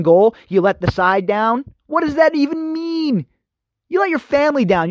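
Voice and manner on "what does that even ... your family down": incredulous. Real, not acted. Host scoffing at the prince's phrase, incredulous. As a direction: pitch it way up, baffled and exasperated.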